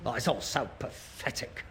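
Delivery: low, very soft, dejected; weary disgust. Acted. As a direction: give up on him, under your breath. Son despairs of father.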